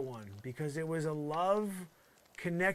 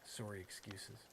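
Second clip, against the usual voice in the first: quiet, slow Canadian "sorry"; 6 semitones lower, 12 dB quieter, slower than usual. Shown as a demonstration. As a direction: show off the Canadian vowel in "sorry", low and soft. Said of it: "I did a Canadian sorry there".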